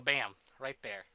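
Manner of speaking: normal talking voice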